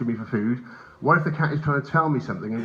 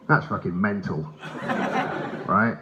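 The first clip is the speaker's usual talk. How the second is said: ordinary talk, nothing marked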